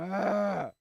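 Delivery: loud, high cry of exasperation; frustrated yell. Real, not acted. Cuts off repeated meme in frustration.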